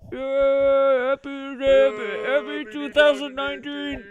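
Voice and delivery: voices muffled behind cupped hands, high and distant; muffled through hands. Acted. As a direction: cheer it through your hands like from another room. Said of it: "my voice and Sam's voice muffled through, our hands".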